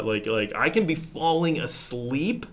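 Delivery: ordinary talk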